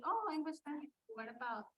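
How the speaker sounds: ordinary delivery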